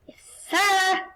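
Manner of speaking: loud high victory cry; triumphant shout. Real, not acted. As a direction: yell it in triumph.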